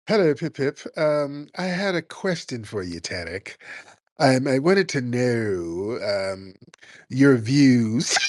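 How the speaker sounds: hammed-up sassy British caller; mock him with a prim, sassy British accent. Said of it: "With a sassy British accent".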